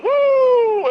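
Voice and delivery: high, loud cowboy whoop; a wild yeehaw-style woo. Acted. [name] miming [name] swinging it around, loud high whoop.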